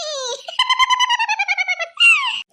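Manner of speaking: shrill gleeful cackle; loud high cackle. Acted. Rich partying girl, loud gleeful cackle. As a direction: burst into a high, loud laugh. Wild laugh.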